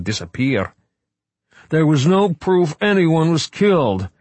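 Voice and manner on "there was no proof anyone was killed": high, loud wail. Acted. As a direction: wail it out in protest. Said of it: "Wright wailed".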